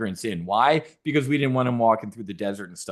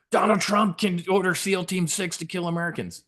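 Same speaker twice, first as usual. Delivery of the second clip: mocking Democrats' alarm; 6 semitones higher than usual.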